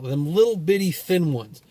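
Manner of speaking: normal talking voice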